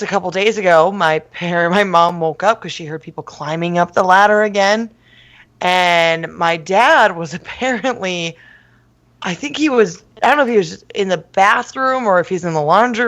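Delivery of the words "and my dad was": normal talking voice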